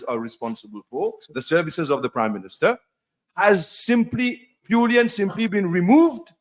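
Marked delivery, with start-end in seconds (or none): 3.4-6.3 indignant. Real, not acted. Indignant question in parliament.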